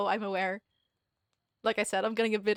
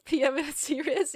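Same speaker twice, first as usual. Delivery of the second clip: hammed-up nickname; 6 semitones higher, 5 dB louder than usual. Gushing, coining a nickname.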